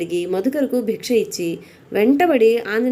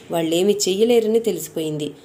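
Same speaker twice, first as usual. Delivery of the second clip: ordinary delivery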